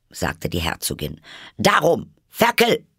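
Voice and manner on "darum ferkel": a sudden, much higher and louder snap; fierce outburst. A narrator's reading. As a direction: bark it at the baby with real force. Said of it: "mit solcher Heftigkeit". (heftig und laut angefahren)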